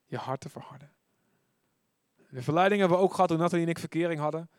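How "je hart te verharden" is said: whispered repeat of hart te verharden. Shown as a demonstration. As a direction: breathe the warning softly. (fluisterend herhaald)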